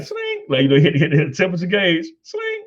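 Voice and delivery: very high squeaky 'sling'; playful mimicry. A dad mimicking slinging a temperature gauge, squeaky high. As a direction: act out the gauge flicking.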